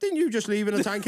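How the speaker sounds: high, disbelieving put-on voice; lightly squeaky outraged questioner; mock incredulous question. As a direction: ham up the baffled officer. Retold army yarn, questioning quote.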